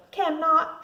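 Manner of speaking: put-on high, loud childish scream. A narrator's reading. Sister screaming back at her brother at dinner. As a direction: yell it back at him. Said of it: "I screamed".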